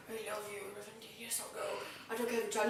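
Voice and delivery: dying whisper. Retold or imitated. Retelling dying Valjean.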